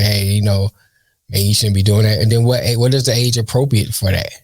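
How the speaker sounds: ordinary talk, nothing marked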